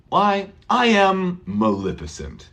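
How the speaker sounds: Why I am Maleficent said with a falling tune, not a question; statement why. Shown as a demonstration. Teacher modelling Maleficent's old-fashioned statement. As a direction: say why as a proud declaration, falling at the end. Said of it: "people don't say".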